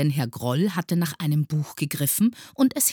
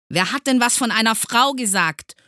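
loud, high, indignant question; exasperated; 9 semitones higher, 8 dB louder than usual. A narrator's reading. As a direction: demand it, losing patience. Exasperated teacher quarrels with pupil. (aufgebracht und laut fragend)

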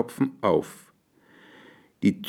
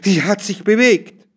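hoch und laut aufschreien, voller Schreck; schreckhafter, hoher Ausruf; erschrockener Aufschrei; 9 semitones higher, 6 dB louder than usual. A narrator's reading. (erschrocken aufschreien)

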